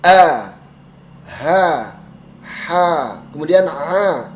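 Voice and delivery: A and H said with lips slightly smiling; smiling letters. Shown as a demonstration. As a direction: demonstrate A, H with a gentle smile. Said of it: "Ini semuanya agak senyum". (semuanya agak disenyumkan)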